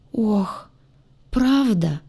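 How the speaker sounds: high, tremulous "правда"; trembling. A narrator's reading. Demon asking if she means it, trembling "правда". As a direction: say "правда" high and wavering, doubtful. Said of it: "в дрожащем «правда»".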